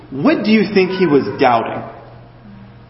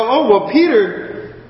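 much higher, teasing come-on-Peter tone; mock exasperation; 8 semitones higher than usual. Preacher teasingly chides Peter for doubting, higher mock exasperation. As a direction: playfully scold him, pitched up. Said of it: "I go".

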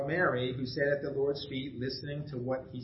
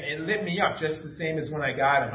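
ordinary delivery

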